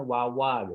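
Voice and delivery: "Wawa" with both R sounds turned into W. Retold or imitated. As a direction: say "Wawa", lips rounded, no tongue R. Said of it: "Dulu mungkin gua nyebutnya".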